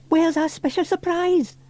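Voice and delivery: put-on very high, eager child's question; high-pitched, impatient excitement; boy asking excitedly. A narrator's reading. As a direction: ask it as an excited little boy. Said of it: "asked James excitedly".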